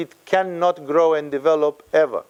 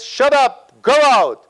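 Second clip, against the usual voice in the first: loud, higher, abrupt order; commanding; 7 semitones higher, 6 dB louder, slower than usual. Acted. Jesus ordering with the power of the word, commanding. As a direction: cast it out in two forceful bursts. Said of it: "He says".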